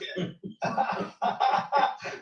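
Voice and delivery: airy high laugh; breathy laugh. Real, not acted. Teasing a guest for staying on mute, breathy laugh. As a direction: let out a quick breathy laugh. Laughs after telling Joe to grow up.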